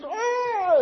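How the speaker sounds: high, loud imitated scream of joy; retold scream. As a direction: let out a sudden joyful shriek, imitating the teacher. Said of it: "and she screams".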